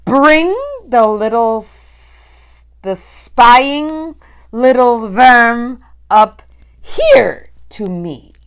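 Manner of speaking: loud, slow, spiteful witch voice; sneering witch command. A narrator's reading. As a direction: savour the word worm. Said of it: "shouted the Grand High Witch".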